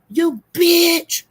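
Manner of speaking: high, drawn-out "you bitch"; demonstrated insult. Shown as a demonstration. Coaching Lola to cuss haters out, demonstrated insult. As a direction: pitch it way up and stretch it out. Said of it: "Just say".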